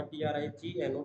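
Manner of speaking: each letter of "टी आर आई जी एन ओ" said separately and clearly; spelled out letter by letter. Shown as a demonstration. Student spelling the subject name for viewers, letter by letter. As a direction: spell "टी आर आई जी एन ओ" one letter at a time. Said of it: "spells trigno letter by letter".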